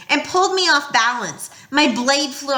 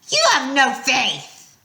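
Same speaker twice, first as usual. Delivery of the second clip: slow, scornful monster voice; 4 semitones higher, slower than usual. Acted. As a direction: sneer it slowly. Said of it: "Echidna told me".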